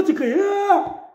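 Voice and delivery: ordinary delivery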